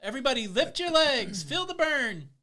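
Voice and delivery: loud, high rallying shout; workout instructor. Pretend. Host leads a pretend virtual workout, shouting encouragement. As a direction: pump up the class like an aerobics coach. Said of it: "doing a virtual workout with me".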